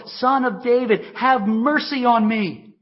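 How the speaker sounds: loud, high desperate plea. A narrator's reading. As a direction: call out even harder for mercy. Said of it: "he cried out all the more".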